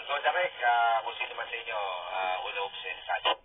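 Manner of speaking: ordinary talk, nothing marked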